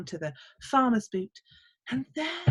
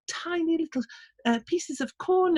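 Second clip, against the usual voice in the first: ordinary delivery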